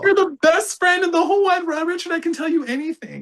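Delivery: very high, gushing mock voice; hammed-up falsetto affection; friend-zoning girl. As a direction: squeak it as a caricature of a girl. Mimics girl friend-zoning him.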